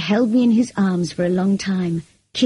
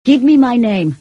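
louder, building, insistent plea; urgent cry; 4 semitones higher, 5 dB louder than usual. A narrator's reading. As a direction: press urgently for an answer. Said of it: "he cried".

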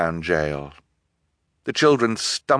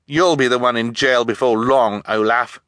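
put-on raised, defiant boy; mildly bold challenge, louder; putting on certainty; 5 semitones higher, 4 dB louder than usual. A narrator's reading. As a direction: stand up to the villain. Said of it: "hoping he sounded much more certain than he felt".

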